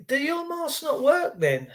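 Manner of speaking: high, soft, slow sarcastic question; sly mocking jab; mock taunt. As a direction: needle a masked person with fake innocence. Taunting a masked person.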